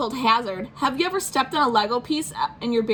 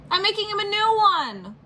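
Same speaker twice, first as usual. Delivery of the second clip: put-on higher childish protest; whiny kid voice; 11 semitones higher, 3 dB louder than usual. Spencer protesting to mom, higher childish whine. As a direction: protest like a stubborn little boy.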